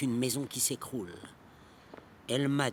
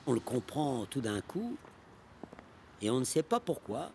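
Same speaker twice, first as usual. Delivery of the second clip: hushed dread; 7 dB quieter than usual. Acted. Poet confiding to his young companion how death dawns, hushed dread. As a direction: let the voice sink softly into bleak reflection.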